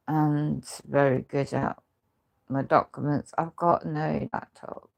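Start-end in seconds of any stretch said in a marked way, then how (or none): none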